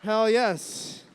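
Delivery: loud cheer of hell yes. Real, not acted. Host rallying the crowd before the next act, loud cheer. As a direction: shout it to rally the room.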